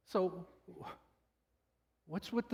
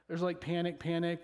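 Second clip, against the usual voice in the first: usual speaking voice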